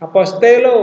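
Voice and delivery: "Apostelo" with a strong accent; stressed accent. Shown as a demonstration. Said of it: "Kalau ini apostelo".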